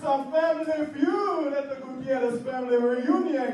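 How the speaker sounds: loud, hyped announcer calling out to the crowd; game show host. Acted. Host welcoming the family reunion crowd, loud game-show shout. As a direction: pump up the room like a TV host.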